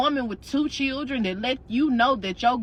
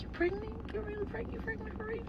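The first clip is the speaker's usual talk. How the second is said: hushed gossip question; 10 semitones higher, 15 dB quieter, faster than usual. Retold or imitated. Friend asks secretly at church.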